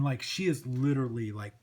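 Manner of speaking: ordinary delivery, nothing marked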